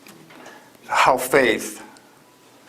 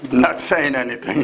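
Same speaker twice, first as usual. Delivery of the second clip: ordinary talk, nothing marked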